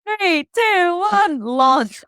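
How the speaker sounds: high, loud countdown; countdown call. Retold or imitated. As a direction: call out the launch. Retold countdown before the jump.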